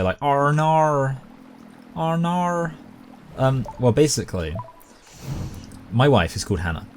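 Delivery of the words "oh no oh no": high, drawn-out Australian "Oh no"; Australian accent. Retold or imitated. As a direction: exaggerate an Australian "oh nar". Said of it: "joke about how they say".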